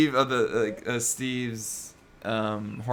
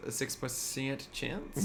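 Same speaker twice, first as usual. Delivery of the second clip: drawn out; 2 semitones lower, 11 dB quieter, slower than usual. Real, not acted. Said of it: "Don't know why I said it like that".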